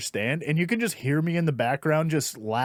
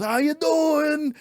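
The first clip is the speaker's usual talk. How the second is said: much higher, very slow drawn-out catchphrase; catchphrase delivery; 10 semitones higher, much slower than usual. Retold or imitated. Comic's signature line to the crowd, slow and high. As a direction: stretch the catchphrase out. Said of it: "his big catch line was".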